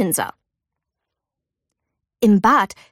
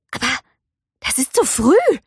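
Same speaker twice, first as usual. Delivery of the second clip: surprised mother; 4 semitones higher than usual. A narrator's reading. Ming gasps surprised.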